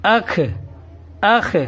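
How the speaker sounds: اخ sounded out as "akh", short a into throaty kh; demonstrated اخ, "akh", said separately; alif joined to khe. Shown as a demonstration. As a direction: close each اخ on a throaty kh. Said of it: "خے کے ساتھ الف کو جوڑیں گے".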